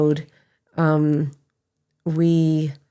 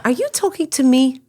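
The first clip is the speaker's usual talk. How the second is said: high, startled are you talking to me; turning around, caught off guard; surprised question; 7 semitones higher, 3 dB louder than usual. Retold or imitated. She turns to the stranger, high and surprised.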